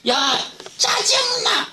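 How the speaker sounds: very high, stretched-out mock shout; annoyed outburst. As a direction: lash out in acted exasperation, dragging the words. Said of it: "이렇게 된다면". (짜증 내며 길게 지르는 고함)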